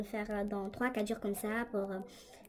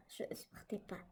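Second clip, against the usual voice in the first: "Je supportais pas" whispered, no voice at all; 2 semitones lower, 12 dB quieter than usual. Real, not acted. Telling viewers she couldn't bear it, in a whisper. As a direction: let "Je supportais pas" fall to a bare breath. Confessing nightly fear, voice drops.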